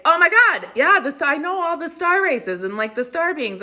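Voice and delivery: high, loud, over-eager imitation; mock gushing. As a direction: mimic an excited know-it-all. Mocks people claiming spiritual knowledge.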